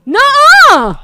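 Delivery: high, loud cry of disbelief; shocked disbelief. Real, not acted. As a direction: refuse loudly to believe it. Hears star was in store.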